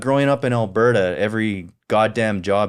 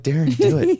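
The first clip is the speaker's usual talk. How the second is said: much higher urging voice; high-pitched mock nagging; Crystal; 14 semitones higher than usual. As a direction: mimic someone pushing you to act. Voicing Crystal urging him on.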